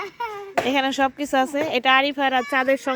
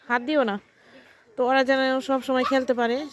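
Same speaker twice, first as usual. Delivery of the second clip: normal talking voice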